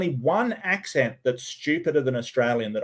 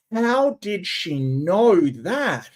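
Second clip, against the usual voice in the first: mock amazement; 3 semitones higher, much slower than usual. Sarcastic amazed audience member.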